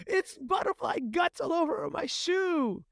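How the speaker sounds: mock-hysterical high wail, drawn out; freaking out. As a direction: whine it slowly and high like a distraught kid. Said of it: "as she's freaking out".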